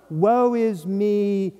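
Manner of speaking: slow, whiny woe-is-me voice; mock self-pity. Preacher mocking false humility with a slow whiny woe is me. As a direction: drag it out like a self-pitying martyr. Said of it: "wrongful self-deprecation".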